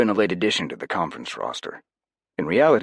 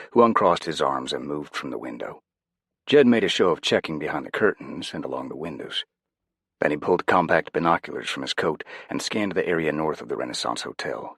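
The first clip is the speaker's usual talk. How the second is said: ordinary delivery, nothing marked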